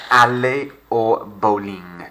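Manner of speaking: aller au bowling in careful French, bowling said the French way; French pronunciation. Shown as a demonstration. Teacher models French phrase for pupils, careful clear pronunciation. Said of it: "we've got to French it up".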